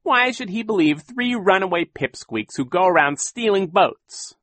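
high, mocking villain voice rising on orphans; sneering villain. A narrator's reading. Captain Sham taunting the orphans, high and mocking. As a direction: taunt them like a smug pirate.

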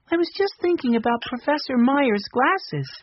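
put-on high, light little boy voice; young Benny. A narrator's reading. Narrator voicing young Benny.

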